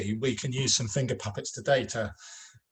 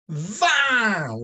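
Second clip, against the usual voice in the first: celebration; 10 semitones higher, 6 dB louder than usual. Shown as a demonstration. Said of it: "we do our celebration for vingt".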